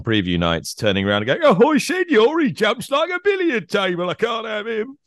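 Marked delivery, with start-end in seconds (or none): 1.4-5.0 much higher put-on comic voice; comic character voice. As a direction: ham it up as a know-it-all pundit. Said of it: "that gentleman you were doing an impression of there?".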